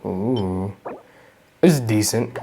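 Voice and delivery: usual speaking voice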